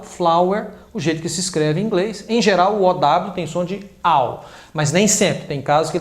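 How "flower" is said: flower said FLAU-er, stress on the first syllable; spoken pronunciation. Shown as a demonstration. Teacher models how flower is spoken. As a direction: say flower with the au sound. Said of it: "O jeito que se fala".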